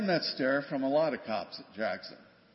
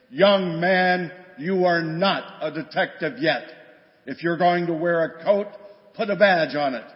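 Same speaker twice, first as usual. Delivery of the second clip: booming lieutenant's rebuke, much louder; sarcastically friendly bark; 3 semitones higher, 9 dB louder than usual. Retold or imitated. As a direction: roar it at a rookie, mock-friendly. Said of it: "in a very friendly manner".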